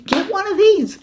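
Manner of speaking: jumps way up high to sell it; excited push. Real, not acted. Host urging viewers to grab one, excited high squeal. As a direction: squeal the pitch upward like a bargain find.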